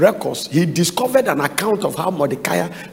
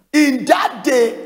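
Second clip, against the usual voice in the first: preaching shout; 7 semitones higher, slower than usual. Acted. Preacher proclaims scripture.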